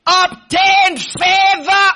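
slow, high, loud proclamation; proclaiming. Real, not acted. Preacher proclaiming Esther's favor to the congregation, loud and slow. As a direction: stretch every word out to the whole hall.

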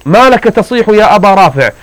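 mock alarm, as if coming to help; feigned concern. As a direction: fake worried rescuer asking what's wrong. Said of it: "كأنني أنجده".

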